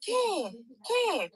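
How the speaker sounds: high, soft calling of a name; mother calling out Ken; a lightly voiced anxious mother; mom calling.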